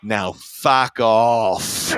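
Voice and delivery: very slow, drawn-out put-on dismissal with a slight smile. Rock star dismisses adoring fan with slight smile, slow amused drawl. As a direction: stretch each word, amused beneath the insult. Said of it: "with this ever so slight smile".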